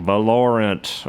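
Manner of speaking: Valorant drawled slowly as vuh-LOR-ant, Southern style; Southern accent. Retold or imitated. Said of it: "my Southern accent".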